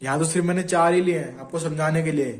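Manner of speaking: usual speaking voice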